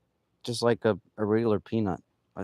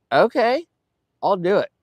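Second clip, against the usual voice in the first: higher, louder quoted acceptance; retold eager agreement; 10 semitones higher, 7 dB louder than usual. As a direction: act out agreeing to the idea.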